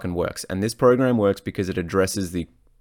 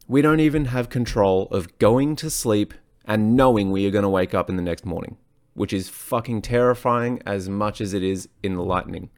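ordinary delivery

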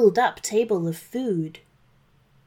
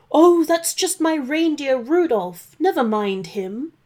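flustered Santa; 6 semitones higher, 4 dB louder than usual. Acted. Santa excusing Rudolph.

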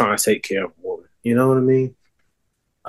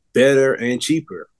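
ordinary talk

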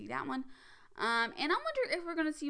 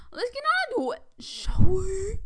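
high, loud, sing-song announcer lilt; mock grand intro; 9 semitones higher, 13 dB louder, slower than usual. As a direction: ham it up like a showy host kicking off. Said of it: "All right, let's get on to it".

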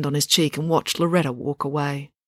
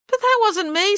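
very high-pitched indignant denial; protesting. A narrator's reading. Finn denies sneaking into April's room, protesting. Wrongly accused brother protests.

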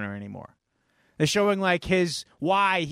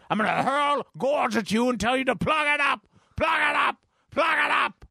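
high shouted fanatic command; Carrie's mother; 6 semitones higher than usual. Retold or imitated. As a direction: rant like a zealous mother. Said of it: "Just like Carrie".

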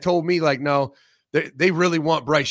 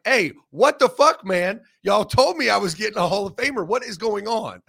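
mock outraged teammate; 4 semitones higher, 5 dB louder than usual. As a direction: play the betrayed receiver, hammed-up. Imagined outraged teammate Garrett Wilson.

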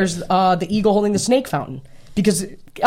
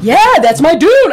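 excited; 8 semitones higher, 8 dB louder than usual. Real, not acted. Hyping favourite director.

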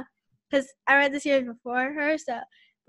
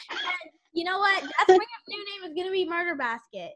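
ordinary talk

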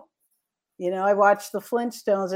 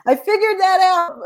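excited laugh; 10 semitones higher, 8 dB louder than usual. Real, not acted. Laughing realisation about her crush.

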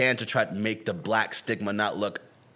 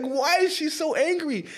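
high, stretched-out baffled question; incredulous question; slower than usual. Retold or imitated. Reacting to the awards video, incredulous. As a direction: retell your disbelief, slow and high-pitched. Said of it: "it was like".

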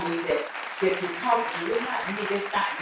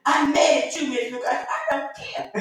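high-pitched mock tantrum; put-on childish defiance; mad little boy; 6 semitones higher than usual. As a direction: imitate a defiant kid talking back. Acting the angry little boy.

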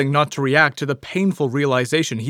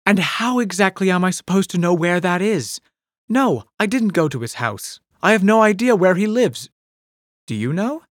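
higher, sharp exasperated retort; exasperated Polly; 7 semitones higher than usual. A narrator's reading. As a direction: throw the question back at him.

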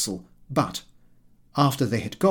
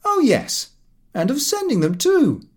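cheeky higher reply; 12 semitones higher, 4 dB louder than usual. A narrator's reading. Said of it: "He replied".

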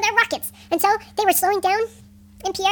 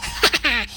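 low, mocking pirate "ha ha ha"; villain laugh; 6 semitones lower than usual. A narrator's reading. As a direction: laugh scornfully at the heroes.

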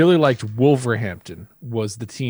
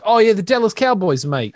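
higher-pitched mock British accent; British person; 7 semitones higher, 3 dB louder than usual. American mimicking a British Dallas Cowboys fan, mock British accent. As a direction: put on a jokey British voice. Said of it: "when you meet a British person".

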